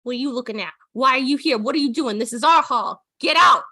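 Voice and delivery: indignant, rising to a yell; angry bear shouting. Acted. Sun bear yelling at intruders, angry. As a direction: chase them out of the hall. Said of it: "he turns around and goes".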